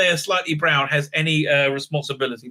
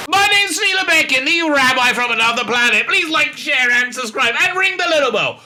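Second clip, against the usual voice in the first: much higher, louder YouTube sign-off; upbeat outro; 10 semitones higher, 4 dB louder than usual. Acted. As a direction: pump up the channel outro.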